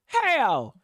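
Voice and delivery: ordinary delivery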